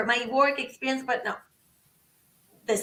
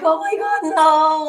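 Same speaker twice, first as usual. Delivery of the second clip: high, loud re-enacted outcry; panicked dismay; 4 semitones higher, 7 dB louder than usual. Applicant when the helpful call hangs up, panicked dismay. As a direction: cry out as if it just slipped away.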